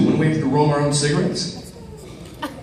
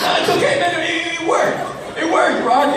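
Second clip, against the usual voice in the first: laughing reassurance; 13 semitones higher than usual. Retold or imitated.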